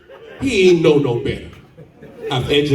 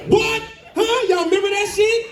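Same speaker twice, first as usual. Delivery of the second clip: high, outraged disbelief; incredulous. Real, not acted. Comedian ranting to the crowd in high disbelief. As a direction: squeal it like you can't believe it.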